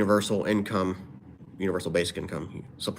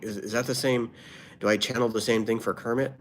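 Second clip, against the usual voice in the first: ordinary talk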